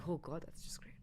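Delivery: a sarcastic whisper. Retold or imitated. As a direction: breathe it out ironically, barely voiced. Sarcastic relief in dream.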